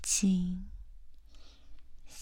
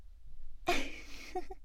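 airy high giggle; teasing giggle; 10 semitones higher, 7 dB quieter than usual. Acted. Teasing roleplay laugh. (轻笑)

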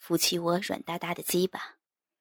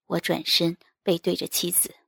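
ordinary delivery, nothing marked

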